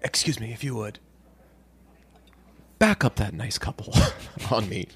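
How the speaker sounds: soft, hushed aside to a server; discreet mock request. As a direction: play a quiet big spender summoning the waiter. Acting discreet order to a server.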